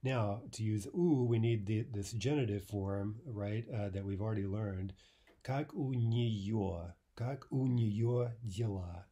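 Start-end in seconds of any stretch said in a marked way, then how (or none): 5.5-9.1 very slow, careful teaching delivery; half-speed language demonstration; slow model phrase. Shown as a demonstration. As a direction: stretch out each word for the class.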